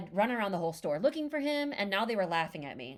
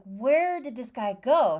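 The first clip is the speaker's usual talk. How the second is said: louder, slower, puzzled call; where did he go, said slowly and louder; exasperated searching; 2 semitones higher, 6 dB louder, slower than usual. Retold or imitated. Retelling her search for Carrie, loud slow puzzled.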